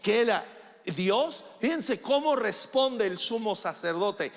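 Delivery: loud, high, driving pulpit voice; full-force preaching. Acted. A preacher thunders at his congregation, loud and high. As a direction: preach it hard to the whole church.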